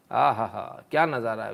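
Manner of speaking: ordinary delivery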